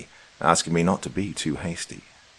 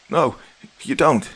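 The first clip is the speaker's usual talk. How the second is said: high, louder desperate cry; 8 semitones higher, 4 dB louder than usual. A narrator's reading. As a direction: cry out in protest, pitched up. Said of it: "she cried".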